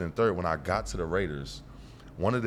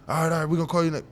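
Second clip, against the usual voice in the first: higher-pitched imitation of a phone caller; team caller; 8 semitones higher, 3 dB louder, much faster than usual. Team calling a player on draft day, imitated caller voice. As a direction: put on a higher voice for the team's recruiter. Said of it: "I get a call".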